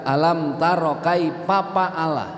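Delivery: "kai papa ala" with P sounds in place of F; Sundanese mispronunciation. Retold or imitated. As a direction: read "papa ala", every F turned to P. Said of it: "Padahal harusnya kaifa fa'ala".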